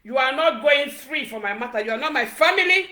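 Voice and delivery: ordinary talk, nothing marked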